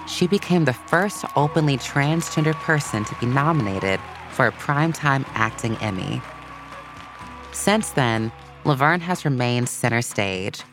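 usual speaking voice

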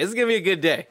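a lightly put-on cheery voice; upbeat self-pep talk. Producer retelling his own upbeat pep talk, lightly put-on. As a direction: cheer yourself up. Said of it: "So I was like".

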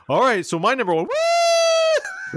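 whooping announcer voice; imitating Bueno. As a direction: count down then whoop high. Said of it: "Bueno say".

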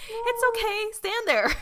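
put-on very high baby-talk reassurance; coddling grandmother. Voices grandmother comforting toddler.

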